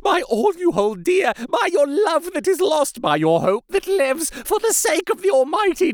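high, desperate pleading building to a wail; frantic tearful entreaty. A narrator's reading. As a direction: beg on your knees with tears rolling down. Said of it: "poured forth a torrent of entreaty".

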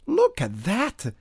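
high, loud, drawn-out amazement; slow, wide-eyed peasant exclamation; marvelling. A narrator's reading. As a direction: show off what you see. Peasant marvels at his blood.

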